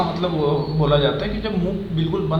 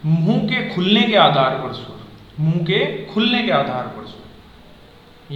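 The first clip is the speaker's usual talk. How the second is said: slow heading; slower than usual. Shown as a demonstration. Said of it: "slow heading read".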